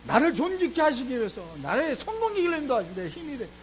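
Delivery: a pastor's fervent sermon climax; full-force preaching. Real, not acted. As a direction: drive it home to the congregation like a revival preacher.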